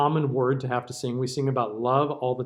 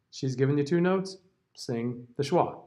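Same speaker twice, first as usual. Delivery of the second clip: normal talking voice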